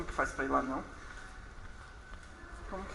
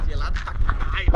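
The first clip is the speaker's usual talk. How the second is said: louder, cursing at the cold; loud cold exclamation; 10 dB louder than usual. Real, not acted. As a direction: shout about the freezing water. Stepping into cold water.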